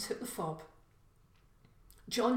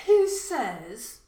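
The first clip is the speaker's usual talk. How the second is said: 1 defiant retort; 4 semitones higher, 12 dB louder than usual. Acted. John challenges intruder.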